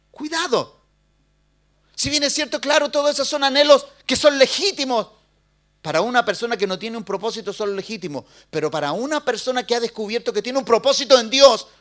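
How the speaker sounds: loud, high, pleading sermon delivery; preaching at full force. Acted. Pastor urges the congregation to finish their course, loud preaching.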